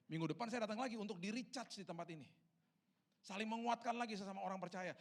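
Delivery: high, ringing sermon voice; rousing preacher in full flow; impassioned preaching. Real, not acted. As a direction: drive it home to the congregation with fervour.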